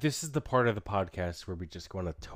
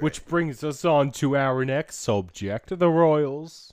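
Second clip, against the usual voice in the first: mock announcer segue; 5 semitones higher, 6 dB louder, much slower than usual.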